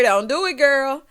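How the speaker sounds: ordinary talk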